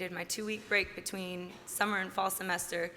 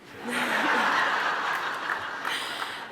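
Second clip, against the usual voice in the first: breathy open laugh; a real burst of laughter; 4 semitones higher, 4 dB louder than usual. Real, not acted. Laughs after mentor's joke.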